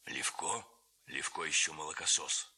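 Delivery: low, half-voiced, hoarse threat; a tall man rasping under his breath. A narrator's reading. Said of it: "говорил хриплый и вполголоса высокий человек". (хриплый голос вполголоса)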